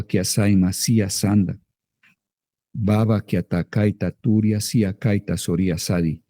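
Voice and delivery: flowing glossolalia, slow, rhythmic syllables; fervent tongues, syllables streaming; speaking in tongues. Shown as a demonstration. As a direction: babble freely in prayer tongues. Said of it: "deja que salgan otras palabras".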